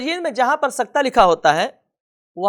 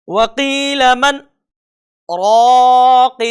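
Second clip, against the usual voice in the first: brief breathless stop after مَنْ, then a long drawn رَاقٍ; slow chant with a held saktah; pause without breath; 4 dB louder, slower than usual. Shown as a demonstration. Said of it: "میں یہاں پر رکا".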